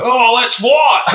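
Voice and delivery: high, hammed-up Aussie challenge, breaking into laughter; imitated Australian accent. Training partner accepting a round, high mock Australian accent. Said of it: "speak to me in an Australian accent".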